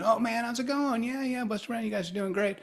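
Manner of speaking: high, soft chummy greeting; upbeat greeting voice. Retold or imitated. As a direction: greet an old friend brightly. Retold friendly greeting.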